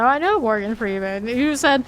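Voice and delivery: ordinary talk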